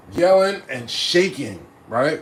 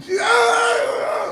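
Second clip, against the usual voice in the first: mock shout. Hyped shout.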